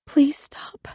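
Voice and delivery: breathy pleading whisper. A narrator's reading. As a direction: beg under your breath. Missus Pinkman whispering still.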